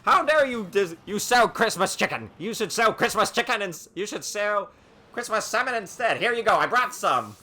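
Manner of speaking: loud, hammed-up finger-wagging rebuke; mock scolding. Monster scolding clerks selling Christmas chicken, loud mock outrage. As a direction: scold with exaggerated indignation. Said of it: "wag his finger at him and scold them".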